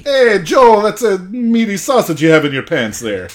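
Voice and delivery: over-the-top flirty put-on voice; campy put-on voice. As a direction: tease him in a hammed-up flirty voice. Teasing mock voice at co-host.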